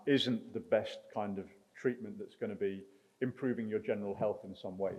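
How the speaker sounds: ordinary talk, nothing marked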